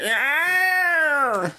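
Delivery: high, loud dino call; dinosaur voice. Pretend. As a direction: bellow it like a dinosaur. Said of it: "that's the dinosaur voice".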